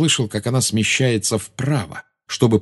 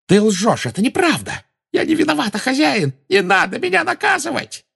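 very high, frantic cry; panicked pleading. A narrator's reading. Witch begs her master not to punish her, shrieking in panic. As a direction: rise from denial to shrieked begging.

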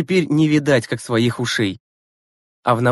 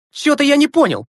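high, loud, stunned bafflement; 13 semitones higher, 4 dB louder than usual. A narrator's reading. Zagorkin, baffled after Gleb brushes past, blurts it out stunned and high. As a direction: blurt it out completely thrown. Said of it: "ошеломлённо проговорил Загоркин".

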